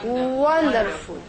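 "wonderful" said slowly, lips rounded on the first syllable; slow, careful "wonderful"; slow model word. Shown as a demonstration. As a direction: stretch "wonderful" so they hear the Waw. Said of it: "you see this circle?".

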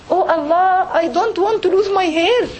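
high-pitched imitation of a tearful plea; retold tearful plea. Friend crying to Allah over losing her hair, pleading. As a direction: plead to God, voice lifted, imitating distress. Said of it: "actually I started crying, but she's saying".

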